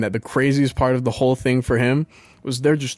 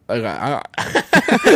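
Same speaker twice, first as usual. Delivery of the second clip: lazy careless voice; much slower than usual. Shown as a demonstration. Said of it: "this is how it sounds".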